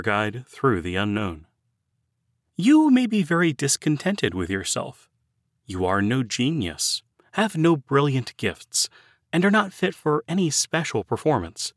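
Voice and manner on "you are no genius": ordinary talk, nothing marked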